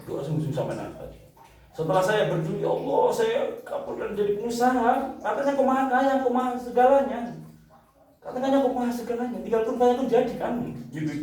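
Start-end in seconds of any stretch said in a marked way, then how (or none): 2.6-10.5 high, insistent pleading, as if instructing God; retold lecturing prayer. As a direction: reason with God in a high, pressing voice. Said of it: "Kayak ngajarin Allah". (menirukan doa menuntut, seolah menggurui)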